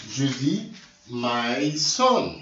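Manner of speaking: "my son" in English, slowly, x0.6. Shown as a demonstration. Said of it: "en anglais". ("my son" lentement, en anglais)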